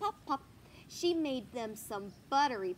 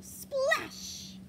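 splash call; 14 semitones higher, 5 dB louder than usual. Acted. Reading a sound word.

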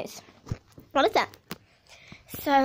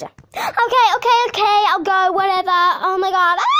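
exasperated loud; 5 semitones higher, 6 dB louder than usual. Acted. Pushed to go up next.

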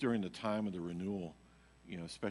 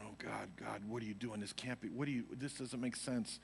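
bewildered murmur; 7 dB quieter than usual. Retold or imitated. Bewildered prayer under his breath.

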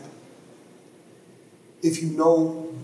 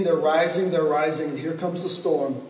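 usual speaking voice

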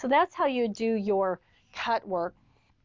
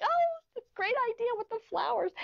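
much higher, fast and breezy; mock chirpy reply; 12 semitones higher than usual. Quilter mimics her own cheerful cover-up answer, high and breezy. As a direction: play it off brightly as if planned all along. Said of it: "I'm like".

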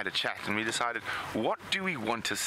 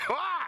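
relieved gasp. Real, not acted. Clearing the bug.

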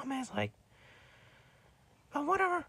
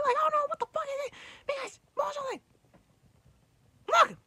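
high, fast, furious rant at Thomas; exasperated outburst at a noisy friend; angry yelling; 9 semitones higher, 3 dB louder than usual. Real, not acted. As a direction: scold him at the top of your voice, higher and faster. Yelling at Froggy/Thomas to shut up.

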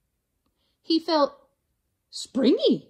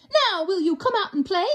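quick, higher invitation; lightly voiced dingo; 8 semitones higher, 3 dB louder, faster than usual. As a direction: invite him cheerfully.

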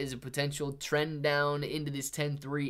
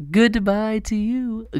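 high, slow mock farewell; sing-song goodbye; 8 semitones higher, 11 dB louder, slower than usual. Waving off the bear market, high slow sing-song goodbye.

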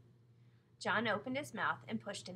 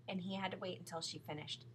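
plain reading voice

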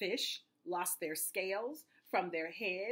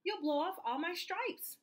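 high-pitched alarmed zebra yelp; 10 semitones higher than usual. A narrator's reading. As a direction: squeal it in storybook alarm without much extra volume. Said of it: "The zebra yelled".

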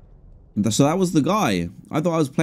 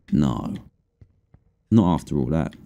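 ordinary talk, nothing marked

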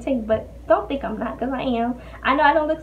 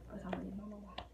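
breathless whisper; winded, barely voiced; shallow breathing; 4 semitones lower, 24 dB quieter, much faster than usual. Real, not acted. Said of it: "So I'm out of breath".

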